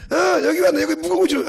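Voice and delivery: put-on high, loud glad call of an old woman; excited greeting. Old lady calling out happily to the young evangelist.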